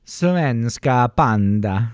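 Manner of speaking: slow, drawn-out mock Swedish; playful slow Swedish accent. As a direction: stretch it out in a Swedish lilt. Playing Swedish panda mascot.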